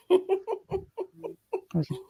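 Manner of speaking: words squeezed out through a laugh. Real, not acted. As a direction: laugh as you say it, slowly. Laughing while telling.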